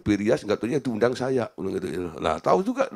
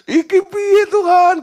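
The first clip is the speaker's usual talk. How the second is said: much higher and louder, crying out; desperate cry to God; 8 dB louder, slower than usual. Retold or imitated. Pastor crying out to God for help, high and loud. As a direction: throw the question up to heaven. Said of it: "Saya lapor, gitu loh, sama bos saya".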